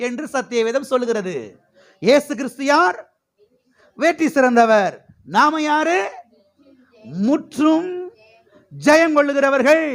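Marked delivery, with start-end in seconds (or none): 2.0-9.9 high rising questions, ringing answers; preaching call-and-response. Real, not acted. Preacher drilling church on who they are, rousing call-and-response. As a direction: quiz the crowd, then proclaim the answer high.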